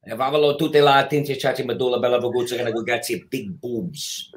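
fast fake Italian gibberish. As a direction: rattle off mock Italian double talk. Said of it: "Fake, fake Italian".

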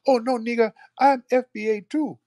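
high, put-on pretender voice; squeaky mock protest; mock liar. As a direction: parody someone faking it. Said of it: "They try to lie".

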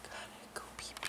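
very soft, whispered little aside; playful whisper. Pretend. Wife needing the potty, playful whisper to herself. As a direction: whisper it barely audibly.

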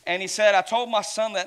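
rising preacher intensity. Real, not acted. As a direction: build the sermon to a peak. Intense sermon climax.

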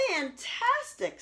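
high, slow, drawn-out praise; mock amazement. Princess flattering the dragon into showing off. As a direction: gush with fake wonder.

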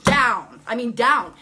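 "down" punched out loud; heavy stress on down. Real, not acted. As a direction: hit each "down" hard and loud. Stresses how hard she fell.

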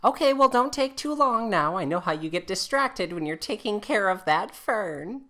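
put-on high wifely voice; jealous wife Jane. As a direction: sing out a pointed reminder. Plays Jane calling from kitchen.

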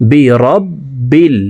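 বিরব্বিল slow with b held twice; doubled letter. Shown as a demonstration. Teacher demonstrating the shadda slowly. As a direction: hold the b in বিরব্বিল. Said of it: "তাসদিদওয়ালা হরফ দুইবার উচ্চারণ".

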